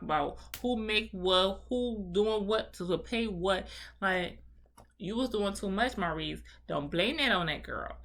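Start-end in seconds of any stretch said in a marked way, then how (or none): none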